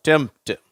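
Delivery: டெம்ப்ட் with the p silent, said as டெம்ட்; teacher showing the silent p in டெம்ப்ட்; model word tempt. Shown as a demonstration. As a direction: say டெம்ப்ட் without the p sound. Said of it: "எப்படி உச்சரிக்கிறோம்".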